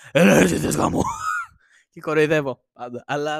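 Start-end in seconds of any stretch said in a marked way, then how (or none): 0.1-1.0 loud, booming mock yell; imitated neighbour shouting. Podcaster mimicking the neighbour he hears on every visit, mock shouting. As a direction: bellow it like the thunder-voiced neighbour. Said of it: "φωνάζει".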